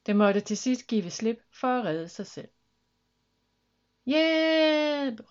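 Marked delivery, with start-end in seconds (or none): 4.1-5.3 long, high cry for help; drawn-out alarmed call; shout for help. A narrator's reading. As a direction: yell it out while falling. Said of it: "råbte Mathilda".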